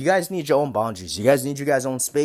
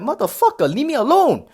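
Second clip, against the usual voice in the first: mock exasperated snap; 10 semitones higher than usual. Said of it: "I'm like".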